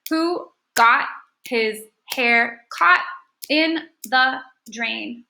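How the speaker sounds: very slow, each syllable set apart; deliberate syllable-by-syllable reading; clapped-out syllables. Shown as a demonstration. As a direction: clap out every syllable as you read. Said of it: "do it one more time and count the syllables".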